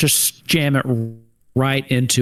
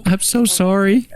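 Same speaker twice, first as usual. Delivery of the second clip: sorry; 7 semitones higher than usual. Acted. Consoling grieving father.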